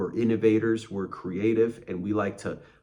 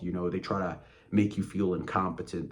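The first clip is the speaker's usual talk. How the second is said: ordinary delivery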